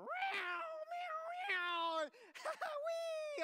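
very high, loud meowing and squealing; a gleeful kid mimicking a cat; toddler squeals.

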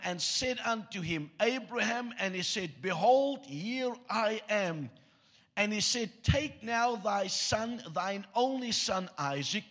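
ordinary talk, nothing marked

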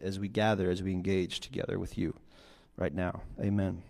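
soft, low, measured praying; prayer. Real, not acted. Preacher praying for the congregation, soft and low.

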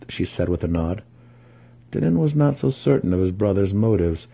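ordinary delivery

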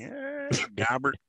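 slow, drowsy mock announcer slurring the name; a lazy tipsy announcer giving it a go; drunk announcer. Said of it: "as he's pouring his third glass of whiskey".

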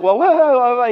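high, loud startled whoa. Retold or imitated. Moses overwhelmed at the burning bush, startled high whoas. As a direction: Moses reeling back in alarm.

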